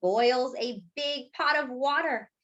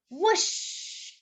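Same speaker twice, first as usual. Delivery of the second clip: breathy whooshing steam noise; whoosh sound; 8 semitones higher than usual. Acted. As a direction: blow it out like a puff of steam.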